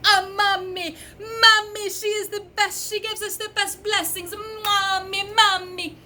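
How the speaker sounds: high, loud mock wailing; imitated Jamaican guy. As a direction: imitate an ecstatic, high-pitched devotee. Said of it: "Jamaican guy starts going".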